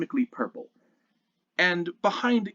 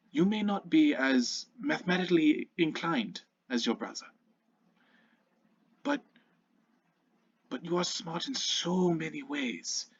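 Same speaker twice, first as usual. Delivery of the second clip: warm father; 4 semitones higher than usual. Acted. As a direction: reassure your shy son tenderly. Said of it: "Brandt smiles very warmly and says".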